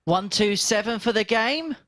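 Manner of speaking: high, slow drawn-out caller shout; darts caller impression. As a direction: call it like the referee. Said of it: "He just wanted to hear the call".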